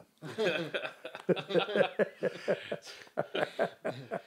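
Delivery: long, high-pitched laugh; sustained laugh after a punchline; real laughter. Real, not acted. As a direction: keep laughing for a good few seconds.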